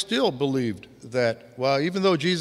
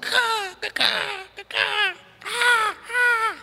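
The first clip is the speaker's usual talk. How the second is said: much higher harsh crow cawing; crow caw imitation; much slower than usual. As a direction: caw like a magpie waking you up. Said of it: "But that sound".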